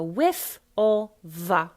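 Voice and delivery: "with all the" as wiff all va, word by word; slow, loud WIFF and VA; Cockney f then v. Shown as a demonstration. Coach drilling the phrase, Cockney f then v. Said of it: "F and then V".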